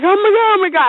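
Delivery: high, rushing mock panic; retold freaking out. Freaking out in the car to husband, retold panic. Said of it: "I'm, like, hyperventilating".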